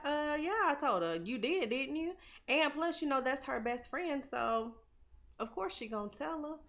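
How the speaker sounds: high-pitched, mock-incredulous retort; pointed comeback. [name] answering Holly's question in the car, mock pointed comeback. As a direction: throw it back as if it's obvious.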